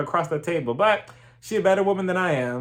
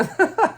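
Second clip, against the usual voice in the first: high burst of laughter; 4 dB louder than usual. Real, not acted. Laughing at the trailer.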